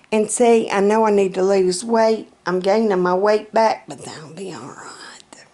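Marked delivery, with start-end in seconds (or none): 3.9-5.5 a breathy whisper, half to herself; whispered aside. Real, not acted. Reassuring herself about her weight, whispered.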